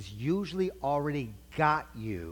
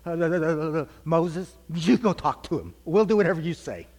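hammed-up frightened stammering, raised and louder; mock scared; 3 semitones higher, 5 dB louder than usual. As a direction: imitate a terrified crowd passing the job off. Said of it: "it scared everybody so bad, they said".

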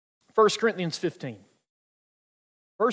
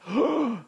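sharp frightened intake of breath; petrified gasp; 5 semitones higher than usual. Acted. Boy allergic to stings sees bee, petrified gasp. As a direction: gasp in mock terror. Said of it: "the boy's petrified".

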